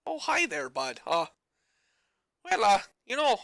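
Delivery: high Newfoundland greeting from the ape; a friendly Newfie hello, drifting Irish; Newfie accent. Acted. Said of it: "slip into Irish or Scottish".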